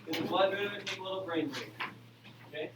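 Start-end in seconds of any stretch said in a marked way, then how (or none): none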